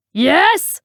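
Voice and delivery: loud, high, rising challenge; booming. A narrator's reading. Tea lady answering a customer, booming. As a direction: boom one word as a challenge. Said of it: "boomed the voice".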